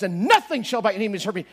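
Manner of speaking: NOTHING hit loud and firm; emphatic. Retold or imitated. Man insisting the verse over his pain, emphatic. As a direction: lean hard on nothing. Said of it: "words would come to emphasize".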